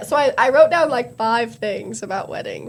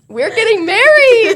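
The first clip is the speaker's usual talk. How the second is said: announcing big news; 11 semitones higher, 11 dB louder than usual. Pretend. Fake wedding announcement joke.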